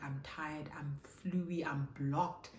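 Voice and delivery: ordinary talk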